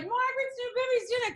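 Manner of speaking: high-pitched teasing singsong quote; lifted, playful voice for the friends' chorus; mock excited friends. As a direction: imitate gossiping girlfriends up high.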